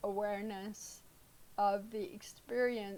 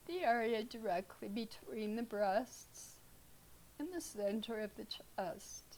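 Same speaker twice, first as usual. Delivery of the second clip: calm, unhurried meditation guide with long pauses; guided meditation; 6 dB quieter than usual. Real, not acted. Teacher leading students through breath awareness, guided meditation. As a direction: ease them into stillness, leaving space between phrases.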